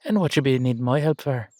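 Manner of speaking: Irish-sounding put-on accent; hammed-up Irish lilt. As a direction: ask it in a thick Irish-style brogue. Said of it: "have to do this in the accent".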